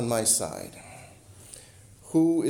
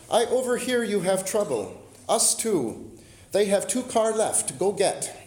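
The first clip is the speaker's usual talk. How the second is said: lightly imitated broken English, a little higher; mock broken English; 6 semitones higher than usual. Stranger at the car rental offering help, light broken English. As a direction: retell her broken English with a light foreign accent. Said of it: "said in very broken English".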